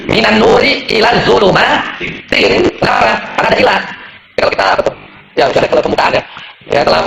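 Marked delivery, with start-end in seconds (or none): 0.1-2.6 very slow, higher-pitched model of "minan nuri ilan zulumatin"; recited -in reading. Shown as a demonstration. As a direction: draw out "zulumatin" to a final -tin. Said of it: "Ya, majrur. Makanya".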